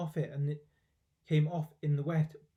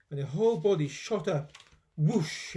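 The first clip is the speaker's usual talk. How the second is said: ordinary delivery, nothing marked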